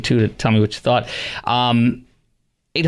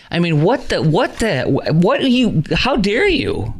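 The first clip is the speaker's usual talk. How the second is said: high, sputtering indignation; real outrage, pitched high; indignant outrage; 6 semitones higher than usual. Real, not acted. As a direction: break off in disbelief, then drag out the rebuke. Host outraged at the toast.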